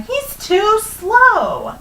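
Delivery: high, loud, long-drawn jeer; gloating taunt. Acted. Hare jeering at the old tortoise, drawn-out gloating.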